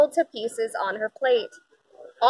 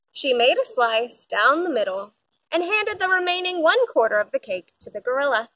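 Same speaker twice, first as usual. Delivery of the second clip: ordinary delivery, nothing marked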